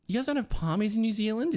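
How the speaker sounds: high, disbelieving question; mock shock. Real, not acted. Teasing the Kiwis over missing Palmies, shocked. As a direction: pitch it up in disbelief.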